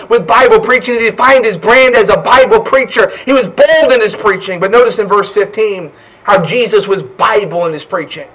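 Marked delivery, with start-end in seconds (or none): none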